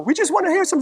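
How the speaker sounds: high, pleading put-on voice; high-voiced fan. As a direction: plead in a high mock falsetto. High-voiced friend pleading with star.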